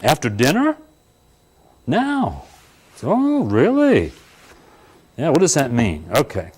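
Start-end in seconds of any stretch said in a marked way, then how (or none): 1.9-2.4 a high-pitched quick answer; high sudden answer. Acted. Speaker answering his own question, high and sudden. 3.0-4.1 high, drawn-out slow oh really; mock surprise. Speaker mocking an audience reply, high slow surprise.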